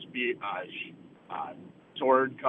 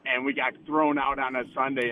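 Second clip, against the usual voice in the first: ordinary talk, nothing marked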